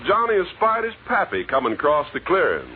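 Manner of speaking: normal talking voice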